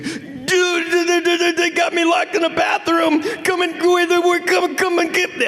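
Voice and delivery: high, shouted mock panic; high frantic plea for rescue; panicked friend. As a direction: squeal it like you're trapped. Panicked friend calling from bathroom.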